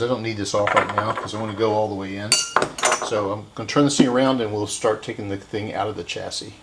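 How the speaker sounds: ordinary talk, nothing marked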